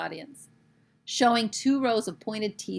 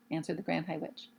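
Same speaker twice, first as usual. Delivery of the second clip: ordinary delivery, nothing marked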